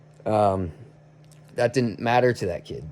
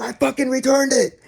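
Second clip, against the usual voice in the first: much higher mock outburst; freaked-out snap; 13 semitones higher than usual. Kid accused over drywall knife, imitated freaked-out snap. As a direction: imitate him blowing up, voice shooting up. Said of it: "he freaked out again".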